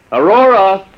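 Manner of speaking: loud angry call across house. Acted. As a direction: call her name, annoyed. Calling wife, upset about boarder.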